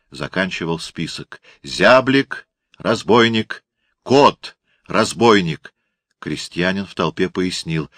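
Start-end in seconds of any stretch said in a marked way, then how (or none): 1.6-5.7 loud, slow names cried out; thundering town crier. A narrator's reading. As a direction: boom each name across the square. Crier reads list.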